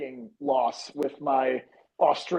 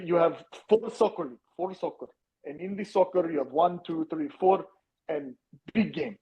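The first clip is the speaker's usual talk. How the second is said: put-on English accent. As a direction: play a mock English pundit with a lightly put-on English accent. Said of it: "I can do my accent".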